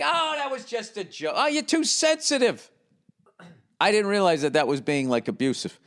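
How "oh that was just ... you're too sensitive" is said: high, dismissive whine; mocking gaslighter. Mocking an abuser telling his partner she's too sensitive. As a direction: sneer it off as a joke. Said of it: "Or like".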